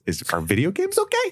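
high, sheepish, wheedling ask; mock sheepish question. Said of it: "he texted us".

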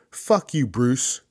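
a pretend furious call to Bruce; mock angry phone call. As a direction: tell him off down the phone. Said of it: "I would call him myself and go".

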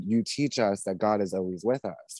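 normal talking voice

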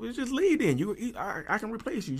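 high, heated mock retort in an argument; retold angry retort. Husband mimics his furious retort to his wife. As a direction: throw it back at her, mimicking the fight.

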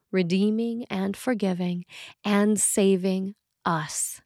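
ordinary talk

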